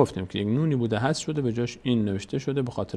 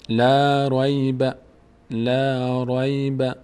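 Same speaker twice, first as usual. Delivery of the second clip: very drawn-out, level syllables; slow model reading; much slower than usual. Shown as a demonstration. Teacher joining the syllables for learners, slow measured reading. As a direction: stretch each vowel on one even note.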